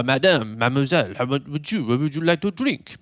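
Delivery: mock French waiter, lilting up on the question; French waiter accent. French waiter addressing the lady, mock French accent. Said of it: "French waiter guy".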